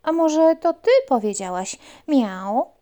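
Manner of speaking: loud puppy question. Acted. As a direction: ask the frog hopefully. Puppy asks frog.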